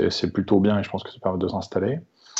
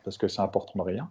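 normal talking voice

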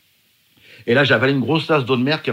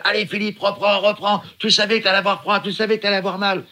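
higher mock scolding, urging him on; half-acted telling-off to keep going; team scolding him; 6 semitones higher than usual. Team scolding the tired swimmer, higher urgent scold. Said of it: "mon équipe m'engueule".

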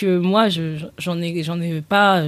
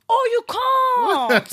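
mock British revelation, "can't" with a long open "ah"; British accent; much slower than usual. English hosts finally understanding the written word, exaggerated British accent. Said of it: "Au bout d'un moment, je leur ai écrit".

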